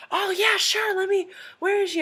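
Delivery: high, loud, eager imitation; retold enthusiastic yes; eager agreement. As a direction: agree keenly, pitched right up. Voicing the actor eagerly agreeing.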